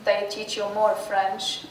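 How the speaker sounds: normal talking voice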